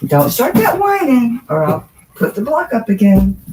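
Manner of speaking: scolding the dog. Real, not acted. Owner warns her whining dog about the block, loud and stern. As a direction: bark a firm warning at him.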